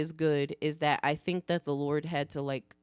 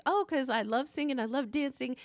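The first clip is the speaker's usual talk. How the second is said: put-on high, boastful child voice; imitating a self-satisfied kid; herself as a kid; 6 semitones higher, faster than usual. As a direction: play a little show-off, pitched up. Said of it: "that was me as a kid".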